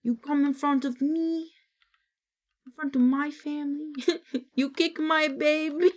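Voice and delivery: lightly voiced angry immigrant from the meme; quoting meme. Streamer quoting an audio meme to chat, lightly voiced angry father. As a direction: recite the meme with a mild mock grievance. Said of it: "that audio meme".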